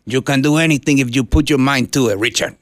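put-on a dad's gentle Puerto Rican accent, encouraging his son; a hint of a Puerto Rican dad's accent. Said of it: "thick Puerto Rican accent".

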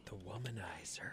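breathy whispered product name. Pretend. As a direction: whisper it like a secret.

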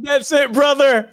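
excited. Retold or imitated. Voicing the broker's approval.

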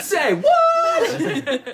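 high, loud, stretched-out disbelief; exaggerated shriek of shock; comic shocked catchphrase. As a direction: belt it out like a catchphrase. Shocked catchphrase, repeated for laughs.

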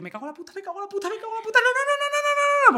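swearing that climbs into high panic; re-enacted meltdown. Daughter seeing mother in two places, acting out collapse. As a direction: spiral up into a high, frantic run of no's. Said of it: "porque yo entré en colapso".

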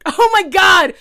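loud, high shocked shout; shocked exclaim. Real, not acted. Realizing the date copied the Big Fish shoe trick, shocked shout. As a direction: burst out in disbelief.

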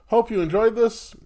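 bright sign-off. Real, not acted. Host's cheerful sign-off.

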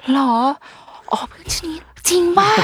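high-pitched, hammed-up curiosity; theatrical, high "oh really?" reaction; play-acted interest. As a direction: act amazed at a child's story. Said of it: "คือเล่นละครทุกวัน".

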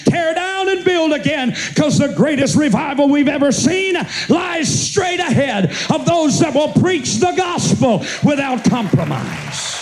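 full-throated revival preaching, almost singing; preaching at full force. Acted. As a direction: carry the church up to a chanted peak. Preacher builds to sung climax.

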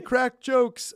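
mock cheer. Mocking their own habit.

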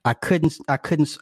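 usual speaking voice

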